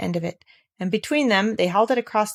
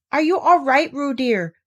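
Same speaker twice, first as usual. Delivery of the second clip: higher, louder worried calling; anxious call; 6 semitones higher, 4 dB louder than usual. Acted. Kanga calls anxiously to Roo in the water. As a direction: call out to your child in worry. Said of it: "called Kanga anxiously".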